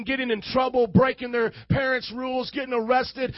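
ordinary delivery

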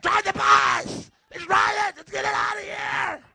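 panicked shouting at the driver; frantic shout. Retold or imitated. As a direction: yell at him to just go. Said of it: "I'm like".

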